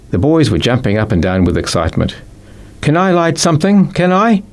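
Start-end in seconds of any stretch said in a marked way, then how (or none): 2.8-4.4 put-on much higher, pleading little boy; eager little boy asking; excited child. A narrator's reading. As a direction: beg like a hopping kid. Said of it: "asked the little boy".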